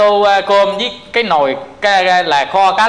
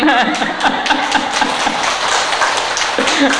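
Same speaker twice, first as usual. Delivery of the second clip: long breathy laugh at own joke; helpless chuckling mid-story; real amused laughter; 5 semitones higher than usual. Real, not acted.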